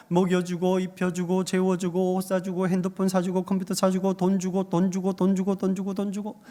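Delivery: even, level run of repeated phrases; monotone listing. Real, not acted. Preacher lists everything doting parents give their child, monotone listing. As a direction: reel off the list on one note. Reels off all parents provide.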